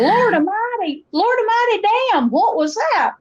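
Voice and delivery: high, swooping Appalachian outcry; high-pitched Appalachian astonishment; Appalachian exclamation. Acted. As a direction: exclaim it in full mountain dialect. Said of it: "I could use phrases like".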